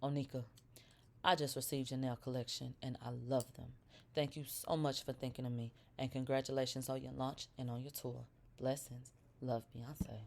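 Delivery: low, soft and hushed, like [name] reading her own note. Shown as a demonstration. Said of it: "Let's do it like [name]".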